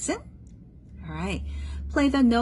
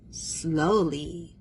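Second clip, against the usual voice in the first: long, lingering vowels; drawn-out slow; 2 semitones lower than usual. Shown as a demonstration. Teacher showing pupil what adagio means, drawn-out slow. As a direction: stretch the word out slowly. Word acted out as its meaning.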